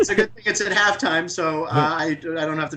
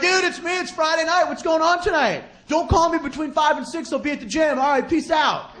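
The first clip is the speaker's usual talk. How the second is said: higher, breezy bro voicemail; an annoying friend leaving a voicemail, higher and breezy; annoying friend's message; 9 semitones higher than usual. Retold or imitated. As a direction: rattle it off like a casual bro. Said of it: "They're like".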